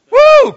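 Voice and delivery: high, loud preacher's whoop; exultant woo. Real, not acted. Preacher whooping over the verse. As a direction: let out a joyful whoop.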